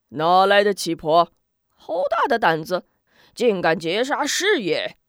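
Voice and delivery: loud, menacing challenge; a brute barking at an intruder; bandit chief's anger. Acted. Bandit chief confronts old woman.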